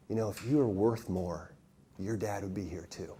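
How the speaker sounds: low, soft, insinuating murmur; insinuating whisperer. Retold or imitated. The enemy sidling up to a fatherless boy, softly insinuating. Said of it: "whisper ideas and images into my head".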